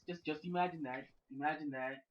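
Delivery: ordinary talk, nothing marked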